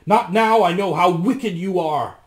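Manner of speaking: loud, high accusing cry; shout. A narrator's reading. Rasmus rejects the wicked witch, shouting. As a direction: shout your refusal accusingly. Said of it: "shouted Rasmus".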